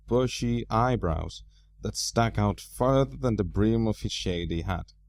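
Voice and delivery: usual speaking voice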